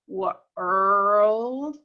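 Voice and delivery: "World" said with the R sound and no O heard. Shown as a demonstration. Teacher sounding out "World" so the class hears the R. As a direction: stretch "World" so only the R comes through. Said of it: "do you hear that O?".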